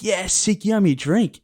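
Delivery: retold eager reply; mildly excited. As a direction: claim your prize, lightly acted. Retold claim of promised drink.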